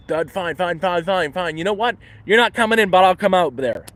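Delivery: high, loud retold outburst, building; exasperated retort. Camper confronts intruder trying to get into tent, retold exasperated outburst. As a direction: build from hurried giving-in to a loud challenge.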